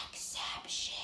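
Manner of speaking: whispered spelled rule. Shown as a demonstration. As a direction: hush it like a secret.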